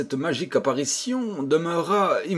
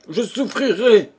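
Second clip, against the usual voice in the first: boldly heroic, higher and louder; 8 semitones higher, 5 dB louder than usual. A narrator's reading. Pierrot vowing to suffer for the children, heroic. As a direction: declare it like a brave hero. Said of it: "dit héroïquement Pierrot".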